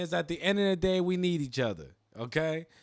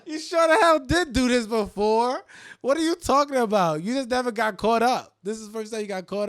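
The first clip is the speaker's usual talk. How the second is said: high, loud calling her out; loud teasing rebuke; mock scolding; 7 semitones higher, 9 dB louder than usual. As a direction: scold her for lying, half-laughing. Scolding the friend loudly.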